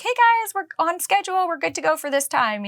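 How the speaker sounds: high, upbeat streamer greeting; excited shout out. Retold or imitated. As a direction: cheerfully greet the viewers. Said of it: "a little shout out".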